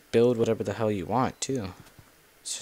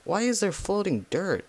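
baffled surprise; 10 semitones higher than usual. Real, not acted. Spots odd floating dirt.

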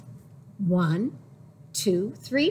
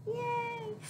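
high little cheer; a soft delighted yay; 12 semitones higher, 8 dB quieter than usual. Real, not acted. Cheering for the baby.

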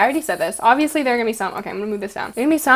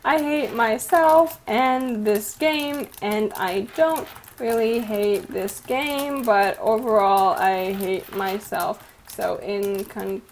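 halting word by word, sinking lower and softer; slow dictation; 3 semitones lower, 4 dB quieter, slower than usual. Real, not acted. Vlogger typing her conclusion aloud, slow fading dictation. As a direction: say each word slowly as you type it.

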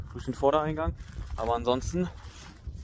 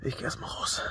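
whispering; 9 dB quieter than usual. Real, not acted. Whispering in the morning.

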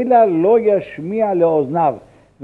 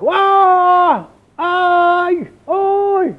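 high wailing cries of וואו, איי, אוי; imitated shouting in prayer; 13 semitones higher, 3 dB louder, much slower than usual. Rabbi mimics a man wailing at the tomb, high and loud. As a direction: wail out like a man crying in prayer. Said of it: "צועק".

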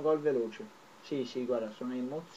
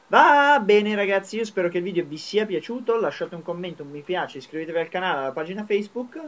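youtube outro; 4 semitones higher, 7 dB louder than usual. Real, not acted.

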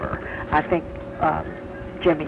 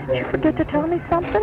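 pointed question; 11 semitones higher, much faster than usual. Retold or imitated. Confronting husband about missed news.

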